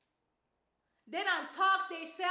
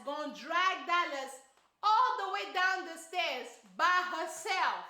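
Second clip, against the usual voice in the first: ordinary talk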